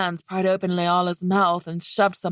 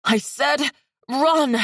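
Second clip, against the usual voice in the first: angry; 7 semitones higher, slower than usual. Acted. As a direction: yell it at her angrily. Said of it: "Leala said angrily".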